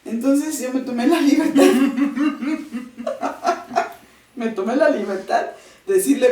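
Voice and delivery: laughing while telling it; giggling through the words. Real, not acted. Laughs telling happy memory. (riendo mientras cuenta)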